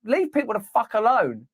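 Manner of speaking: ordinary talk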